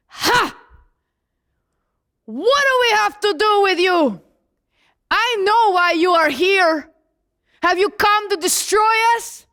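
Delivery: demon-possessed man. Acted. Possessed man challenges Jesus in synagogue, high demonic outcry. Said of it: "he stands up and he goes".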